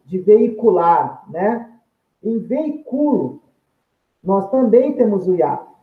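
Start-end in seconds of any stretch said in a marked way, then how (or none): 2.4-3.4 veiculo drawn out slowly, stress on CU. Shown as a demonstration. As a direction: land on the u of veiculo, with the i unstressed. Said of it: "é o u".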